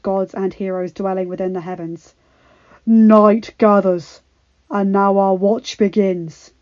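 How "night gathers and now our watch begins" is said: slow, louder dark vow; dark gravelly oath. Acted. Jon swearing the Watch oath to all soldiers, dark. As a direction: intone it like a solemn oath. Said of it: "His voice turned dark and gravelly".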